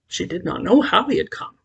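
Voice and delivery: usual speaking voice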